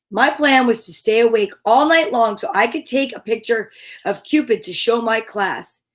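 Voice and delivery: ordinary delivery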